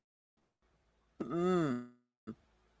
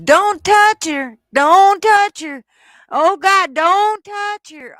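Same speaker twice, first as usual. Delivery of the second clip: much higher, loud and slow mock plea; drawn-out, high, loud parody of a plea; mock pleading woman; 11 semitones higher, 8 dB louder, slower than usual. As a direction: whine it like the hysterical woman begging.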